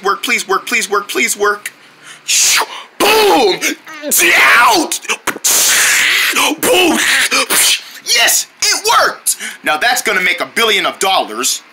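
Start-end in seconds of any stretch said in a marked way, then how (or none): 3.0-7.0 shouted boom. Pretend. Toy explosion sounds. 8.0-9.4 high, slow triumphant yes; excited cheer. Real, not acted. Kid thrilled his rocket worked, excited cheer.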